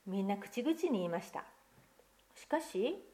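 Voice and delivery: put-on lively picture-book reading to children; story narration. Pastor reading a picture book to children, lively storytelling. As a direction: read the tale aloud to the congregation, expressive.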